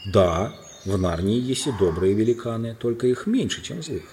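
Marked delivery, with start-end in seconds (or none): none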